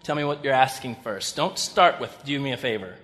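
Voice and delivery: quiet, guarded, fading to almost nothing; wary reply. Retold or imitated. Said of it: "I'm always like".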